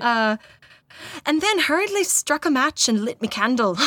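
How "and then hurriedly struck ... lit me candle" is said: put-on overdone Irish accent, higher pitched; hammy Irish storytelling accent; attempted Irish accent. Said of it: "It doesn't say me".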